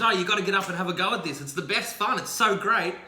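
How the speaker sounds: put-on boy luring the man, bright and rushed; excited kid. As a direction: sell the fun like a kid. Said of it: "The kid goes".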